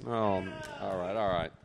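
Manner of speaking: ordinary talk, nothing marked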